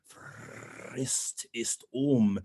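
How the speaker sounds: "Frist" with an extended trilled R; best rolled R. Shown as a demonstration. Coach passes on his coach's tip, long rolled R in "Frist". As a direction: trill long on "Frist", then release "ist um". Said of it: "Give me the best R".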